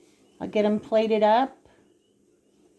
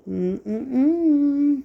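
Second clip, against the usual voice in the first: savouring hum; 4 semitones higher than usual. Real, not acted. Savouring food on camera.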